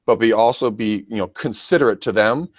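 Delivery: ordinary delivery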